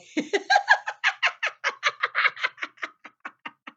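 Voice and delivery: high, loud gleeful laughter; long joyful laughter. Acted. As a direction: laugh with wild delight. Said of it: "Max laughed aloud".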